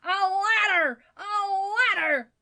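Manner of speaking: high, loud, drawn-out call; vociferous shout. A narrator's reading. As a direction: bellow urgently for help. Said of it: "vociferated Mister Badger".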